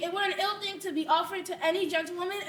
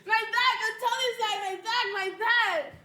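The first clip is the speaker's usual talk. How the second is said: higher, louder groaning about her aches; loud complaining; 4 semitones higher, 4 dB louder than usual. Acted. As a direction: wail about your aching back. Nurse moans about her aches.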